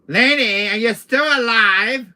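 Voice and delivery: loud, high, drawn-out call. Acted. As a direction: shout to check on her, stretching the words. Calling out to the woman.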